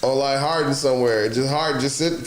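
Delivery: usual speaking voice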